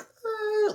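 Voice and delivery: high yelp of alarm; panicked yelp at swerving car; alarmed yell. Retold or imitated. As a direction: cry out in panic.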